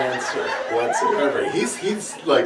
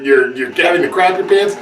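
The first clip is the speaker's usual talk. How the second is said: usual speaking voice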